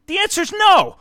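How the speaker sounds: high, loud firm refusal. Retold or imitated. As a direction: shut him down, loud and high. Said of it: "but I raised my voice".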